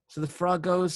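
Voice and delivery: ordinary talk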